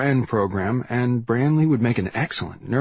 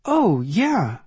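put-on girl's voice, smiling, higher; 8 semitones higher than usual. A narrator's reading. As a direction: reassure him with a smile in your voice. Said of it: "I can hear the smile".

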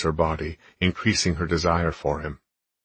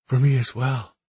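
female character voice; 7 semitones higher, 3 dB louder than usual. A narrator's reading. Heroine speaks.

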